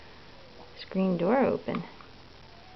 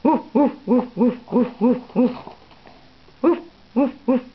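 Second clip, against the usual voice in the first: dog barking imitation; 3 semitones higher, 5 dB louder than usual. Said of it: "Do they say".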